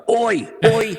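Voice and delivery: strong, high-pitched Australian call; mock heavy-duty Australian accent. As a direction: call it out in thick, heavy Aussie. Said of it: "heavy, strong, like".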